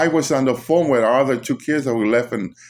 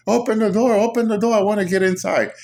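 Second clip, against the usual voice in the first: faked pleading voice; 9 semitones higher than usual. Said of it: "faking the voice to try to get inside".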